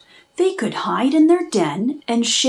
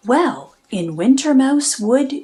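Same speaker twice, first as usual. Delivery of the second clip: usual speaking voice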